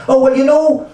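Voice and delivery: imitating a Polish foreman with a laugh; a hammed-up Polish accent; thick Polish accent. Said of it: "he spoke in his very, uh, thick Polish, uh, accent".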